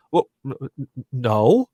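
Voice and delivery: pitiful stammer. Retold or imitated. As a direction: stammer it out like a pitiful kid, lightly voiced. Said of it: "just really, really pitifully".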